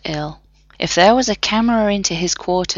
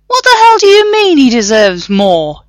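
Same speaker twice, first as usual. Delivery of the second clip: higher, louder protest; indignant objection; 8 semitones higher, 9 dB louder than usual. A narrator's reading. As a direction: object hotly to the screen. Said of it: "John objected".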